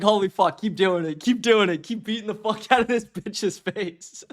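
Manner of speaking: giddy mock cheer; gleeful cheering. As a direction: cheer the violence on with a giggle.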